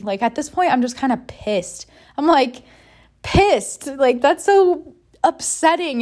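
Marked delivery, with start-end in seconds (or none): 2.2-6.0 real anger. Real, not acted. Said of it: "I feel angry".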